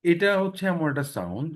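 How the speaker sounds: ordinary talk, nothing marked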